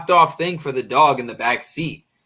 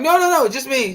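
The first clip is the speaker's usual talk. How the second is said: high, quick no-no-no; hurried denial; 10 semitones higher than usual. Retold or imitated. Hasty denial. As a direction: insist to the driver it's only him. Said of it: "And I'm like".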